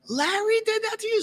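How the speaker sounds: shocked lady. Retold or imitated. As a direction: gasp it out high, horrified.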